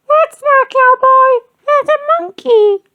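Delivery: squeaky giggly princess; giggling princess. A narrator's reading. Child in princess costume giggling at the party. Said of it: "giggled a princess".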